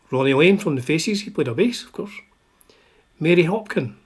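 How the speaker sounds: usual speaking voice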